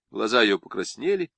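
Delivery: ordinary delivery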